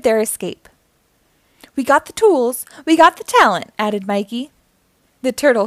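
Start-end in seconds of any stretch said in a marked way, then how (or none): 1.8-3.7 upbeat slogan, rising higher and louder; celebratory boast. A narrator's reading. Mikey celebrates the escape, excited boast. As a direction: build the second phrase up.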